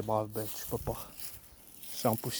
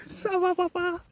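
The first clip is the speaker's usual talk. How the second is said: high pet-talk voice. Real, not acted. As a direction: coo up high at the animal.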